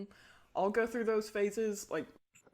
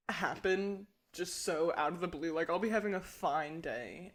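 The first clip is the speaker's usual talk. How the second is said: usual speaking voice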